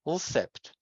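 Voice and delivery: "ulsept" with the l moved before the s; impossible initial cluster. Shown as a demonstration. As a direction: start "ulsept" on the l, then s. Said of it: "change the order of the first two segments".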